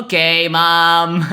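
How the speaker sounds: loud, high, drawn-out mock compliance; slow, exaggerated kid answering mom; hammed-up kid reply. Kid answering mom at drop-off, loud hammed-up drawl.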